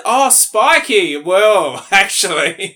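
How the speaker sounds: high, loud, drawn-out comic discovery; mock realisation. Co-host joking what she should have told doctors, mock realisation. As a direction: play at suddenly remembering, hammed up. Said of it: "You should have said".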